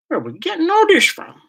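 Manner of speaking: a squeal of disbelief at the chat; incredulous. Real, not acted. Shocked by the chat's turn to fairies.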